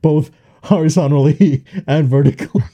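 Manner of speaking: laughing through the words; words broken by giggles. Real, not acted. Joking about ride fitting.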